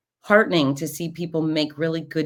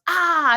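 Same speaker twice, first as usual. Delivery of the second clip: imitated groan of pain; 13 semitones higher, 5 dB louder than usual. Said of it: "his face goes".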